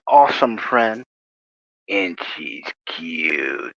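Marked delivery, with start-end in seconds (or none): 1.9-3.7 low, very slow, hushed mock-creepy voice; mock sinister. Introducing his buddy Roxy, in a slow mock-sinister drawl. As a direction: drag it out low and soft, like a playful villain. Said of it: "I'm not sinister".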